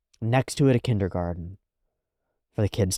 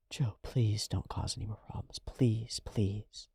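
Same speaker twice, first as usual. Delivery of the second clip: soft plea fading to a whisper; hushed begging, dying to a whisper; pleading softly; 9 dB quieter than usual. Retold or imitated. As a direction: beg quietly, trailing off to a whisper. Begging Joe, fading away.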